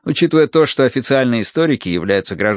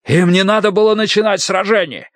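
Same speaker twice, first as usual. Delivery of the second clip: high, heated outcry; raised, high-pitched yell; shouted protest; 10 semitones higher than usual. A narrator's reading. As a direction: cry out against the accusation. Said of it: "закричал командир Дуй".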